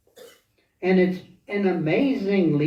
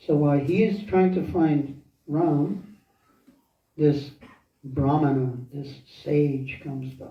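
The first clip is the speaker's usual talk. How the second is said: usual speaking voice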